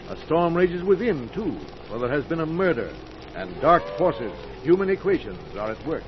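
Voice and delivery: rolling announcer voice over music; old-time radio narrator; dramatic radio narration. Acted. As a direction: set the stormy scene with weight. Announcer narrates radio mystery opening.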